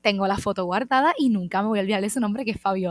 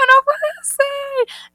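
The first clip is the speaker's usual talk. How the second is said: higher, louder and slow, drawn out; stunned disbelief; 11 dB louder, much slower than usual. Retold or imitated. Recognized in the street, a high, drawn-out gasp of disbelief. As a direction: stretch out the disbelief. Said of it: "Y yo".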